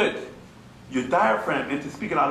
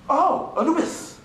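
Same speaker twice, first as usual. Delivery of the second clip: higher, drawn-out mimicry of outsiders; mock outsider's mispronunciation; 13 semitones higher, slower than usual. Teacher mimicking those who can't pronounce it, mock outsider. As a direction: mockingly parrot a learner who gets it wrong. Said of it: "See, they can't pronounce it".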